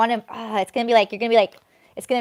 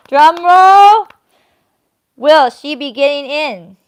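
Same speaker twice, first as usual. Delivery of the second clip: high and loud, teasing the viewers; milk the suspense, loud and bright; announcer hype before the big reveal; hyped-up suspense; 7 semitones higher, 13 dB louder, slower than usual. Real, not acted.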